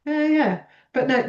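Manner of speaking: ordinary talk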